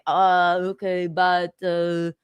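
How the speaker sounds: attempted Austrian accent slipping into French; botched Arnold Schwarzenegger accent. Retelling a husband's hedging to his wife, in a botched Austrian accent. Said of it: "that's more of a French accent".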